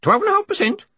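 very high parrot screech; piercingly high bird voice, screamed; screaming cockatoo. A narrator's reading. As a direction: squawk it like a talking cockatoo. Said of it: "screamed the cockatoo".

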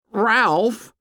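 put-on high-pitched boy's call, rising at the end. A narrator's reading. Fat boy calls to Ralph, high boyish hail.

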